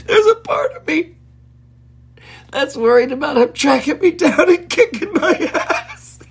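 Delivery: words squeezed out through helpless giggles; laughing fit. Real, not acted. Host cracking up at Bruce Lee writing about the WHO, laughing through it. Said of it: "I'm choking on my own laughter".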